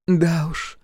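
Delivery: amused sighing woman; sigh with a smile. Acted. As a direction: sigh it out, smiling. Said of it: "вздохнула Вив с улыбкой".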